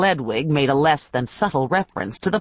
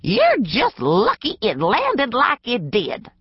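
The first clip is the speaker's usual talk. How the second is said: put-on higher, slow scolding old woman; 7 semitones higher than usual. A narrator's reading. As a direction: scold him like a cranky clerk. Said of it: "his clerk scolded".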